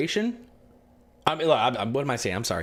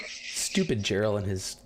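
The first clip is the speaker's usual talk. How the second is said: grumbling mutter; 6 semitones lower, 4 dB quieter, slower than usual. Real, not acted. DM grumbling to himself.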